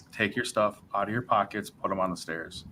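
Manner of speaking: quietly tipping him off, voice dropped; hushed cop aside. Retold or imitated. Friendly cop warning him aside, softly hushed.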